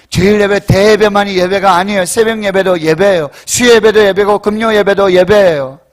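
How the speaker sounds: loud, insistent pulpit delivery; full-force preaching. Real, not acted. Preacher insisting every service is worship, forceful. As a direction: hammer each point home loudly.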